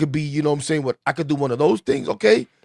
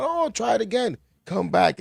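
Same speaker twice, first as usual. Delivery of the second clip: coaxing recruiter; 7 semitones higher, 4 dB quieter than usual. Retold or imitated. Recruiters coaxing him back, imitated.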